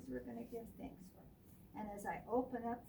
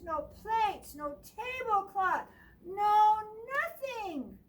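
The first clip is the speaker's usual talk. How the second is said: building; 9 semitones higher, 9 dB louder than usual. A narrator's reading. Building dramatic list.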